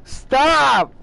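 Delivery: loud, high yelled stop; yelling at chat to stop spamming; shout. Real, not acted. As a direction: cut them off with a sharp shout. Fed up with chat spam.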